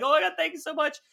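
high-pitched retold gush of thanks; overcome gushing. Actor retelling thanking his idol for the autograph, overcome and high-pitched. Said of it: "I was dying".

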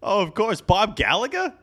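high-pitched, put-on gushing; mock enthusiasm. Host reacting to obscure forty-fours, high mock enthusiasm. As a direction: pitch it way up, as if astonished.

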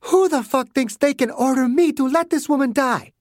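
high, outraged voice; a hint of fury. A narrator's reading. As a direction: spit it out in outrage. Margaret furious at agents.

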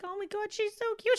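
put-on cooing over baby. Retelling a friend holding the newborn, high coo. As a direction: imitate a friend gushing softly over a baby.

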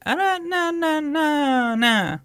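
high, ghostly wail; unearthly voice. Retold or imitated. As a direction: mimic a ghost moaning. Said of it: "It's like".

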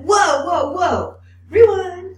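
high, loud startled whoa, slowing on rewind; retold astonished outburst; stunned whoa. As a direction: cry out in shock and ask to hear it again. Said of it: "I was like".

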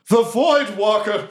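high, drawn-out goblin cry; awed goblins. Acted. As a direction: cry out as a goblin crowd in awe. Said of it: "up at you and they go".

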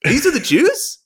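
high, disbelieving squeak; incredulous. Retold or imitated. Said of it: "I was like".

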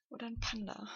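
soft unvoiced whisper. Real, not acted. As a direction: whisper it secretively. Playful whispered joke aside.

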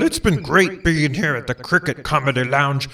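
ordinary delivery

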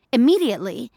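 plain reading voice

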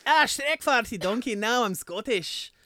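slow, hammed-up Scottish burr; Scottish accent. Shown as a demonstration. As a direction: demonstrate a cartoonish Scottish accent, drawn out. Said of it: "if you want Scottish, you go".

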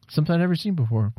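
usual speaking voice

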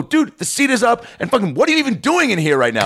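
exasperated comeback, voice up high; high indignant rant. Retold or imitated. Retold heated reply.

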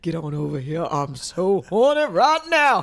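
slow, hammed-up diva impression; Angela Bassett. Woman teasing co-host with a come-on, hammed-up impression. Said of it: "Oh, Angela Bassett".

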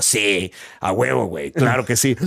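low, mock Mexican swagger; Mexican slang voice. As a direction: play up a fake Mexican. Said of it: "Y yo". (acento mexicano exagerado, grave)

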